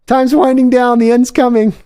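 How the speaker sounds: put-on high, loud whiny old-man wail; mock old man lament. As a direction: wail it like a dramatic old geezer. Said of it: "as I start to go".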